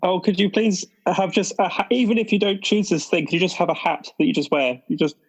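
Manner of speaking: ordinary delivery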